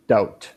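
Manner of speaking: "Doubt" as dout, B left silent; silent B demo. Shown as a demonstration. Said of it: "Silent B".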